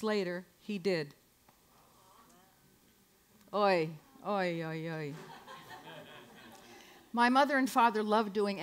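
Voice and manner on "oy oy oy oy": low, drawn-out Yiddish oy; mock lament. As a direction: sigh it like a weary old aunt. Yiddish lament after story.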